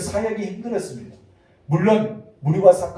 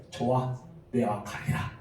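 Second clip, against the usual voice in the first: low, soft voice of consent; relenting; 5 semitones lower, 9 dB quieter, slower than usual. Acted. Preacher as God giving in to Moses, low relenting consent. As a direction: give in quietly, dropping the pitch.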